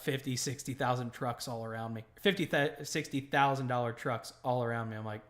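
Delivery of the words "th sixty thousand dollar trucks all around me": ordinary talk, nothing marked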